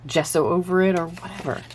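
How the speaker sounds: ordinary talk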